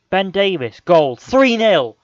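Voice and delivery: high, loud cry of despair at the third goal; real outraged shout; disbelieving shout. Real, not acted. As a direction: yell it out as it goes in. Calling goal conceded in disbelief.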